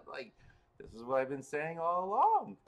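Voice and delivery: finally, vindicated and loud; exasperated. Real, not acted. Exasperated outburst after laugh.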